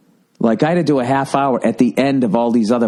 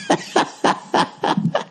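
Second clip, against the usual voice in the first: breathy laugh; 4 dB quieter than usual. Real, not acted. Laugh after the mockery.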